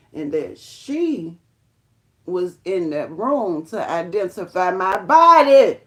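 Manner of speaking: slow, heavy build to a loud finish; dramatic reveal. Real, not acted. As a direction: draw it out word by word, swelling to a shout. Builds to shocking revelation.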